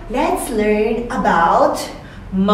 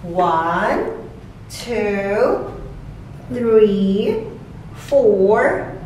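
put-on slow, sing-song counting for kids; slow counting demo; much slower than usual. Shown as a demonstration. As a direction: count each number slowly with a rise. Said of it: "Okay, let's start".

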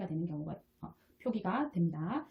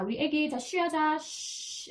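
higher-pitched potty-time coaxing, long hushing 쉬; parent coaxing baby; 7 semitones higher, slower than usual. Acted. Parent gets baby to pee, coaxing shush. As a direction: coax a baby with a drawn-out "shhh". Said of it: "이렇게 하면 애기가".

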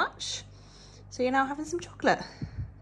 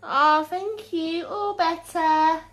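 gushing thanks; 7 semitones higher, 5 dB louder, slower than usual. Acted. Thanks toddler for kiss.

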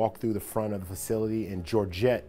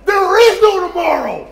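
full-force high roar of the famous line; Carl Weathers; 13 dB louder than usual. Retold or imitated. Reliving the Rocky line that jacked up the team, impassioned shout. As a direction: bellow it like a rousing pregame speech. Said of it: "you can't give a Rocky line".

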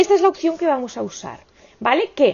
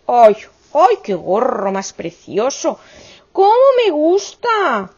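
delighted gushing; 4 dB louder, slower than usual. Real, not acted. The crafter to her viewers, gushing with delight over the finished hat.

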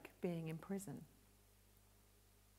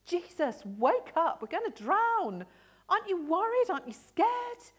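louder and higher, frightened cry over the storm; panicked plea; 9 semitones higher, 7 dB louder than usual. Acted. Disciples beg sleeping Jesus to wake, panicked loud plea. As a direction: shake him awake, we're sinking.

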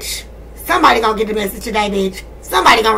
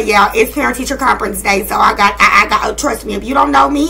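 ordinary delivery

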